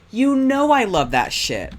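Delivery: high, loud, slow gleeful squeal; excited. Real, not acted. Streamer delighted by a horror dating sim, high excited squeal. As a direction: gush over it, thrilled. Excited about game genre.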